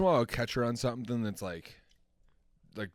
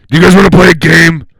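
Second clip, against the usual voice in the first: loud, higher menacing game voice; creepy game voice; 5 semitones higher, 23 dB louder than usual. Acted. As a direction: taunt like a horror villain. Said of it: "that's the voice we use for the game".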